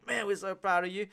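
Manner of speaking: high, loud bro gushing; hyped mates. Retold or imitated. Mates praising him at the drive, loud high gushing. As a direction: lay the praise on thick like a hyped buddy. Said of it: "They're like".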